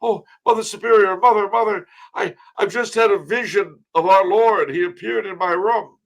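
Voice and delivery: put-on high, loud, gushing young nun; breathless novice. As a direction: burst in overexcited about a vision of the Lord. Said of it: "has a novice come running ... and says".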